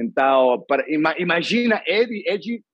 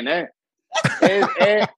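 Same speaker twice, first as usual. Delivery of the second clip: ordinary delivery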